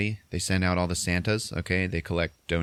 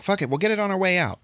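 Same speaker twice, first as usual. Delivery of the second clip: quick high mock brush-off; 12 semitones higher, faster than usual. Retold brush-off.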